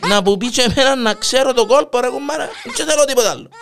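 high, laughing punchline; laughing through it. Real, not acted. Husband begging the cop for the burglar's trick, laughing. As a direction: cracks up while delivering the joke.

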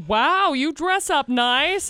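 slow, mock-impressed wow; drawn-out fake praise; backhanded compliment. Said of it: "Such a backhanded compliment".

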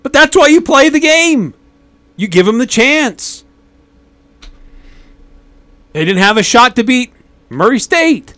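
high and loud, fired-up rebuttal; heated argument. Real, not acted. Heated on-air debate.

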